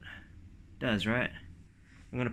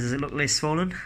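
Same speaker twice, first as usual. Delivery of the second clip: normal talking voice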